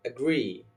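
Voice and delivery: "agree" as a-GREE; slow "agree", weight on GREE; stress on second syllable. Shown as a demonstration. Teacher giving an example verb, second syllable stressed. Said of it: "You must stress the second syllable".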